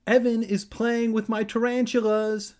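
high, loud tattletale voice; high-pitched telling-on call; tattling Kermit. A narrator's reading. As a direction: report it as a whiny tattle. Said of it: "Kermit reported".

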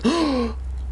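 sharp, acted gasp; loud gasp. A narrator's reading. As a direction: gasp in sudden shock. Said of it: "let out a loud gasp".

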